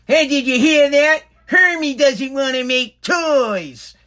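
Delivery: mocking the wife as a tattling head elf.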